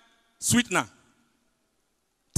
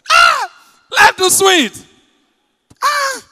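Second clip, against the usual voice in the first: much higher, loud "Ah" then life too sweet; joyful shout; 11 dB louder, much slower than usual. Real, not acted. Comedian celebrating to his audience, joyful loud shout. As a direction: crow it out to the crowd. Catchphrase cry to crowd.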